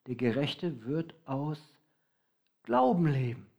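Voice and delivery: "Der Gerechte wird aus" slow, pause, then "Glauben leben" weighted; stress on Glauben. Shown as a demonstration. Preacher shows the congregation the verse's emphasis, slow with a pause. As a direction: hold before "Glauben" and lean on it. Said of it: "Das ist die Betonung".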